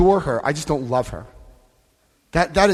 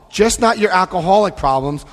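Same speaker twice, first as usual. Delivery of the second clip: ordinary talk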